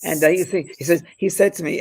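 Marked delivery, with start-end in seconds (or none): none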